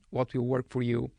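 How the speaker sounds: ordinary talk, nothing marked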